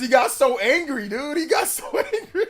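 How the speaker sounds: high, breaking into laughter; amused, laughing. Real, not acted. Streamer recalling the tweeter's anger, high giggling delivery. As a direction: crack up while telling it.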